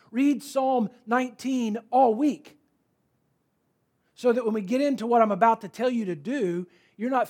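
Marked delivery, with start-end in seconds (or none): 0.1-2.6 "Read Psalm nineteen all week" slowed and louder; slow emphasis. Shown as a demonstration. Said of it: "here's what I'm talking about".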